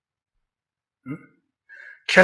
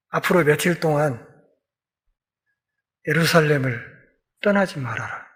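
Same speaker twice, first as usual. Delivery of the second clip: much softer, gentle parting charge; Jesus gently tells his disciples to stay, soft charge; soft instruction; 4 semitones lower, 7 dB quieter than usual. Acted.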